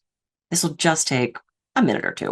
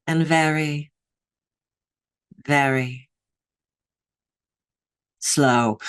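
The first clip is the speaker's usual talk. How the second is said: slow, soothing teacher; slower than usual. Retold or imitated. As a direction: imitate an overly soothing meditation teacher. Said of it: "And she talked like this".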